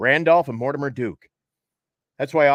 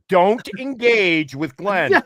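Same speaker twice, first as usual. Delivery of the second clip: slow, loud, raised mock warning; emphatic warning; 6 semitones higher, 5 dB louder, much slower than usual. Management warning the newsman about Glenn, emphatic. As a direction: spell out the imitated order word by word.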